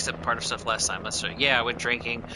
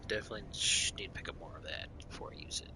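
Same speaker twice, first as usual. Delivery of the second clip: whisper; 7 semitones lower, 10 dB quieter, faster than usual. Real, not acted. Muttering to himself while playing.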